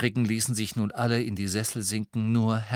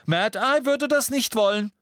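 high cheeky twin voice; 6 dB louder than usual. Acted. George voice, high.

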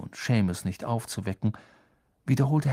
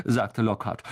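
ordinary delivery, nothing marked

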